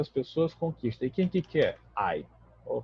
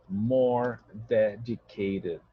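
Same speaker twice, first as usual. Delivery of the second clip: slow model reading; much slower than usual. Shown as a demonstration. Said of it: "teacher models the English".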